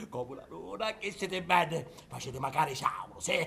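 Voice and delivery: normal talking voice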